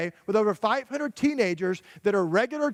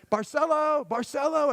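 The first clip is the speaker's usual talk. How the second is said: raised, higher call across a room; calling out a name; 6 semitones higher than usual. Retold or imitated. Translator calling the boy from across the museum, high call. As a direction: call the boy over from far away.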